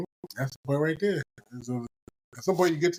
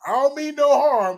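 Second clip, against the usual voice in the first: mock sweet fake politeness; 11 semitones higher, 6 dB louder than usual. Mock insincere phrase.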